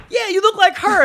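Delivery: loud, very high exclamation; hammed-up eager confirmation; retold excited recognition. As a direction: burst out as if finally vindicated. Retold triumphant recognition by artist.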